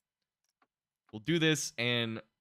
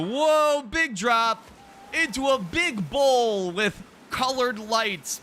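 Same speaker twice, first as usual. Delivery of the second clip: higher and louder, thrilled by the drop; excited whoa; 9 semitones higher, 5 dB louder, slower than usual. Real, not acted. Player riding the slide, thrilled by the drop. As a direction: cry out at the big drop. Raft drops into lit bowl.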